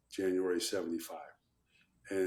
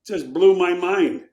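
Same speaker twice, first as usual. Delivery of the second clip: amazed; 8 semitones higher, 11 dB louder, much slower than usual. Real, not acted. Reliving amazement.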